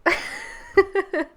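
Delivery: high burst of laughter; delighted laugh. Real, not acted. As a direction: laugh out in disbelief. After shocking card.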